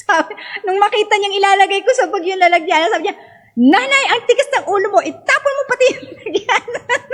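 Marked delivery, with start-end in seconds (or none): 3.6-7.0 fast, high-pitched imitated scolding that breaks into a laugh; angry doctor scolding. Doctor scolds mother for keeping the bottle, mock angry. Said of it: "Galit na galit sa akin".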